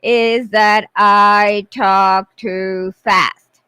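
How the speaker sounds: deliberately slow, drawn-out speech; slowed right down on purpose; slow motion. Real, not acted. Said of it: "yes, I am talking in slow-mo".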